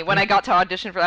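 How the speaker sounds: usual speaking voice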